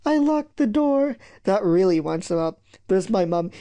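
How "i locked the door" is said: very high, slow, timid confession; weedy little voice. Acted. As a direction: squeak out the confession slowly. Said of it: "In a weedy little voice".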